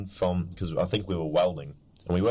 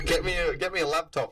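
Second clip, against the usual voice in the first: mock demand; 11 semitones higher than usual. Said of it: "I was like".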